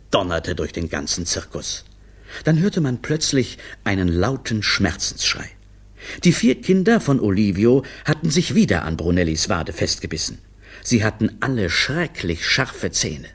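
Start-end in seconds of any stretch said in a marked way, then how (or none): none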